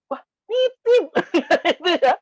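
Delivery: loud, laughing mock astonishment; mock surprise. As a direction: stretch it out high, incredulous and amused. Retelling friend's surprised reply.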